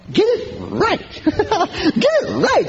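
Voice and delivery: high, drawn-out sing-song; mocking echo. Preacher echoing her phrase in a high mocking lilt. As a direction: savor the silly phrase.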